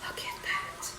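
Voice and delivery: breathy excited whisper; hushed thrilled whisper. Real, not acted. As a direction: whisper it as if you can't believe it. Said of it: "I start to whisper when I get excited".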